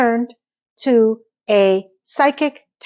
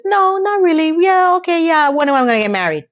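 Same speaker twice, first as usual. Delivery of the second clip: coy; 6 semitones higher, faster than usual. Retold or imitated. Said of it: "Charlotte coyly says".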